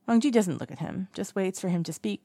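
usual speaking voice

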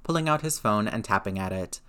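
usual speaking voice

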